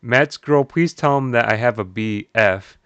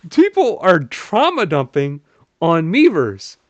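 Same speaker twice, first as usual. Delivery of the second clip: slow, stretched, a bit louder; drawn-out disbelief; 5 semitones higher, 3 dB louder, slower than usual. Real, not acted. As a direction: spell it out slowly, still stunned. Repeats it drawn out.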